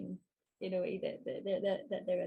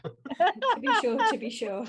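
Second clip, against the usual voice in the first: stage Irish; 6 semitones higher, 8 dB louder than usual. Acted. Mocking the Irish accent.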